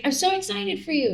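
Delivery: high gushing excitement; excited friend. Retold or imitated. Friend on the phone gushing over her deal, high and excited.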